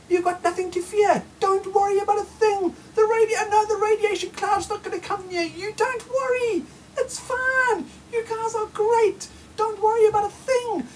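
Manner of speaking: high, sing-song fake reassurance; high mocking reassurance, lightly tearful; mock soothing official. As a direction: coo it like a patronising official calming a child.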